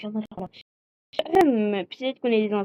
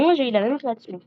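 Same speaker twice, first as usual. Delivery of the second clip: normal talking voice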